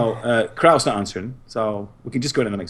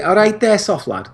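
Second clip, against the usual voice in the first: high-starting Scouse greeting; Scouse accent demo, pitch dropping at the end; Liverpool accent; 9 semitones higher, 4 dB louder than usual. Shown as a demonstration. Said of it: "can you do a Liverpool accent".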